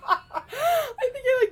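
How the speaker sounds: high, slow words lost in laughing; crying with laughter; helpless laughter. Real, not acted. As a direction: laugh so hard the words stretch.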